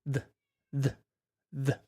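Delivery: "the" repeated softly with a voiced th; th drill. Shown as a demonstration. Teacher drills the th sound for viewers. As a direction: repeat "the", buzzing the th. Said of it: "this T H has a little bit more sound".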